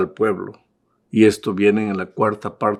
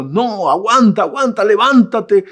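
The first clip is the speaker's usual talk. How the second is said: higher and louder, urging a fighter up; coach shouting; 9 semitones higher, 4 dB louder than usual. Retold or imitated. Boxing coach calling to his staggering fighter, shouting encouragement. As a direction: yell at the boxer to get up. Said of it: "la voz del entrenador".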